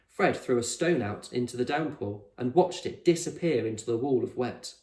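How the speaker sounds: plain reading voice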